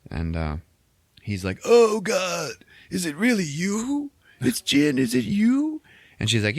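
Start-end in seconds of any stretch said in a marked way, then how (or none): 1.6-5.8 very high, strained character voice; hammed-up disbelieving old rebel. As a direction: gasp out an overwrought mock greeting. Saw's overwrought greeting of Jin.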